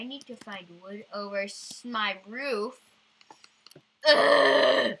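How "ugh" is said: loud groan. Real, not acted. Groan before coughing.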